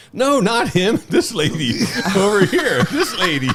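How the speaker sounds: high, loud calling out with laughs; bystander imitation. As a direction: imitate a man calling across the street, laughing. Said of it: "guy across the street's like".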